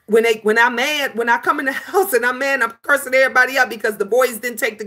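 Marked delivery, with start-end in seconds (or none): none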